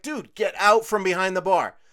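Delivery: loud, slow, firm command; stern order. Retold or imitated. Server ordering a customer out from behind her bar, loud and slow. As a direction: hammer each word to throw him out.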